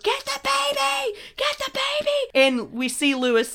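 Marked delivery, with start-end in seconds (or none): 0.0-2.1 thin high mock scream, repeated. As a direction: imitate a useless panicked screamer. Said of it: "just keeps screaming over and over".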